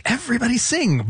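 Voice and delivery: high-pitched "Everybody sing"; mock showbiz cheer. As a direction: call it out high, like a rousing cue. Host voices imagined cheery cue.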